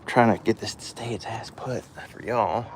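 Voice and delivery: slow strained whisper; straining whisper. Real, not acted. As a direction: whisper it through effort while fighting the part. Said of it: "I'm fighting with this".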